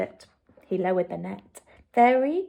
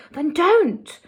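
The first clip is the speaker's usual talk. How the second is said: sharp; 7 semitones higher, 4 dB louder than usual. A narrator's reading. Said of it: "answered sharply".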